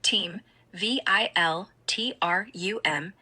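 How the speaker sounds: slow spelled-out reading, dropping to a whisper; slowed-down speech. Acted. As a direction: read each letter slowly and carefully. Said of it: "Ara, slow down".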